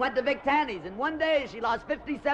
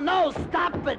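yelling at the dogs; 9 semitones higher, 4 dB louder than usual. Acted. The dogs are fighting.

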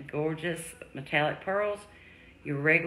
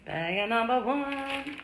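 slow, higher game-show reveal; drawn-out announcement; 7 semitones higher, slower than usual. Acted. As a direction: stretch it out like a prize reveal.

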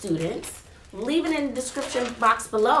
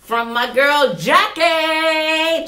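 louder, higher hype; loud shout-out; 5 semitones higher, 7 dB louder, much slower than usual. Real, not acted. Teacher giving an excited shout-out to a viewer. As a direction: call her name out proudly.

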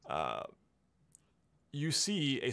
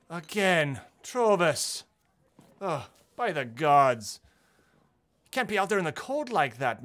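louder, higher scolding motherly cook; fussing cook; 5 semitones higher, 7 dB louder than usual. Acted. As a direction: cluck over him like a worried mother. Portly cook scolds Trovis.

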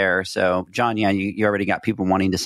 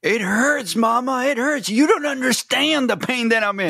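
high, whimpering plea to Mama; mock a grown man crying like a toddler; whiny baby voice; 13 semitones higher than usual. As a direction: friend mocking the injured host whining to his mother. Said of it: "I can see him".

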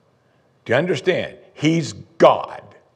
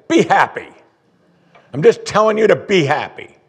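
mock blunt command; 7 semitones higher, 5 dB louder than usual. Pastor voices blunt counsel.

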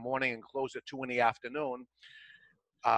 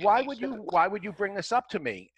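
higher-pitched imitation of an incredulous seller; exasperated disbelief; 7 semitones higher than usual. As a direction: retell his baffled protest, pitched up. Seller reacts to buyer having no money.

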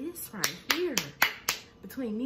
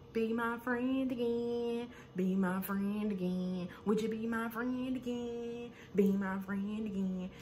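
slow, higher pleading voice, drawn out; pleading plea; 3 semitones higher, slower than usual. Retold or imitated. Imitating Brandy begging Malaysia, slow and pleading. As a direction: beg to be friends again, slowly.

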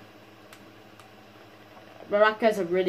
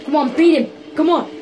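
high, loud bursts of urging; urgent shouting; 5 semitones higher, 4 dB louder than usual. Real, not acted. Gamer urging on the fight, urgent shouting. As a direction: shout them on, pitch climbing.

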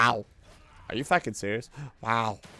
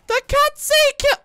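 very high, loud screamed complaint; outraged screech; 11 dB louder than usual. Real, not acted. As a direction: scream it in disbelief. Outraged at dying in game.

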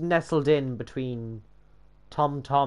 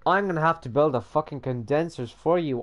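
ordinary delivery

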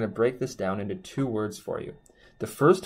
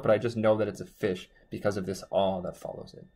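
ordinary delivery

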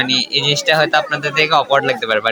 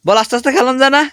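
angry scolding; 11 semitones higher than usual. Real, not acted. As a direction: tell them off for the racket. Scolding noisy kids at night.

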